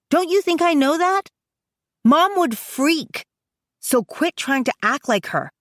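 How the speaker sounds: high-pitched, exasperated comeback; jabbing retort. A narrator's reading. Brother rejecting his sister's mothering, jabbing retort. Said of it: "he jabs at me".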